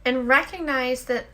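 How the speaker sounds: guided meditation. Acted. Host leading listeners in the daily peace break, soft meditation voice. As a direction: guide them into stillness, unhurried and hushed.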